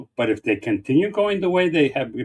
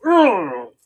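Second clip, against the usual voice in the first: high, loud groan of disgust; disgusted ugh; 7 semitones higher, 6 dB louder than usual. Retold or imitated. Imitating how the left reacts to Moody, disgusted ugh. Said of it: "the left would go".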